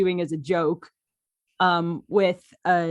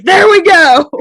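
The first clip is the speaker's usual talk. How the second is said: much higher and louder, crying out with a laugh; triumphant laughing cry; delighted "there we go"; 11 semitones higher, 13 dB louder, slower than usual. Retold or imitated. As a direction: celebrate finding the voice, squealing. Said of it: "And then I was like".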